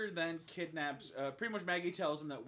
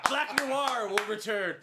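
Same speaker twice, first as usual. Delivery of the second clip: loud, high, slow mock announcement; mock credits announcer; 6 semitones higher, 9 dB louder than usual. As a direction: proclaim it like a sequel teaser. Parody of end-credits announcement.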